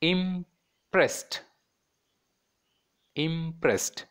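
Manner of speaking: "impressed" split as im-pressed; two separate syllables. Shown as a demonstration. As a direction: hold "im", pause, then "pressed". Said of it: "two syllables".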